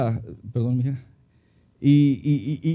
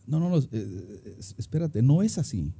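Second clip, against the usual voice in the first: quieter, thinking aloud to himself; self-correction; 3 semitones lower, 6 dB quieter than usual. Retold or imitated. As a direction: catch yourself mid-thought, a touch subdued. Telling himself to stop. (hablándose a sí mismo, bajito)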